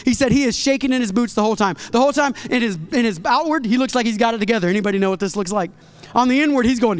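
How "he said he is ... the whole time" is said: normal talking voice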